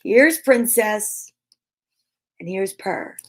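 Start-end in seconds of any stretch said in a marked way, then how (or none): none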